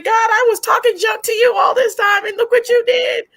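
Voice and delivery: high, shouted mock wail to God; crying, going crazy. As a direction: re-enact an overwhelmed, tearful outburst at high pitch. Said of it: "So I'm crying. I'm going crazy".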